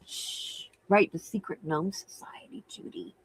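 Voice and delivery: hushed secret whisper; softly whispered aside to a viewer; conspiratorial whisper. Real, not acted.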